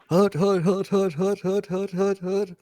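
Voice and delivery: barked hut hut, fading off; quarterback cadence. Pretend. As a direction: call the snap like a quarterback. Quarterback calling the snap count.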